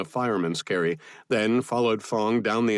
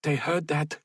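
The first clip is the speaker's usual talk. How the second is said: high, urgent cursing remark; higher, tense warning to companions; cursing alarm; 8 semitones higher than usual. A narrator's reading. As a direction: curse at being discovered. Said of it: "Fong cursed".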